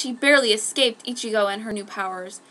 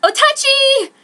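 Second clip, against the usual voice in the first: very high, loud call of his name; cry; 13 dB louder, slower than usual. A narrator's reading. Brother finds wounded Otachi, calls out loudly. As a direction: shout his name across the street. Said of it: "came a cry".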